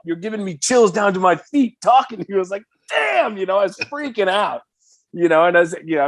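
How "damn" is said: high awed exclamation. Retold or imitated. Singer awed by Carlos's compliment, high exclamation. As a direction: let it out high and amazed.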